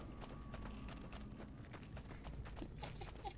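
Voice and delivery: evil cackle. Pretend. Villain laugh in role.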